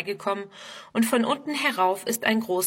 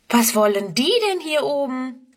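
mildly amazed, pitched higher; astonished question; 5 semitones higher, 3 dB louder than usual. A narrator's reading. Friedrun wonders about the motorbike riders, astonished. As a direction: wonder aloud, lifting the pitch. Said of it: "staunt Friedrun".

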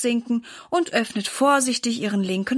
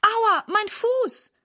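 slow, high, pained moan; aching ouch; 11 semitones higher, much slower than usual. A narrator's reading. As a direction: drag the words out in pain. Mother twisted her ankle.

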